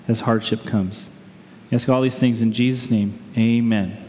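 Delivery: low, unhurried prayer with long pauses; public prayer. Real, not acted. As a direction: pray reverently for the congregation. Leads the congregation in prayer.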